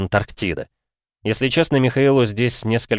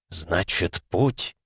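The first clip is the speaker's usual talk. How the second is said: тихо, с улыбкой в голосе; улыбнуться, понимая; 5 dB quieter, much slower than usual. Acted. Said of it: "Широко улыбнулся старый человек". (старик тихо улыбается)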